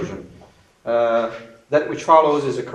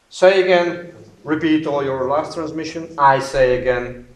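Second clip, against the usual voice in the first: crisp loud radio call; radio procedure demo; 3 semitones higher, 5 dB louder than usual. Shown as a demonstration. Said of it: "For example".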